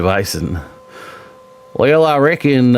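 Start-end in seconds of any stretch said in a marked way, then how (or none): 1.7-2.8 Southern drawl. Acted. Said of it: "I used to do a voice called Bob".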